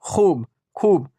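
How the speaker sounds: खूब slowly, with a long oo; drawn-out vowel. Shown as a demonstration. Teacher models the word for learners, खूब stretched. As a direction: hold the oo in खूब. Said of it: "अच्छी तरह से खींच कर".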